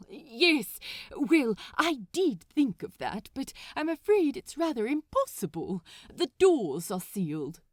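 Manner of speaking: politely at wits' end; exasperated Aziraphale. Acted. Frustrated Aziraphale on phone.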